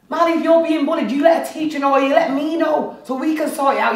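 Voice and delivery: frustrated parental reprimand; exasperated lecture. Acted. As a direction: lay down the rule to an errant child. Telling Marley off for bullying.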